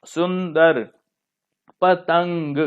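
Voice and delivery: सुंदर, पतंग said syllable by syllable with a held nasal; careful model of सुंदर and पतंग, nasal stretched; nasal sound demonstration. Shown as a demonstration. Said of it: "नाक से स्वर निकलता है".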